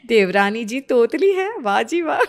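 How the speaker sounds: mock praise through laughter; elder sister-in-law laughs at the bride; laughing mockery. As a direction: tease while giggling. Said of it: "हंसने लगती है".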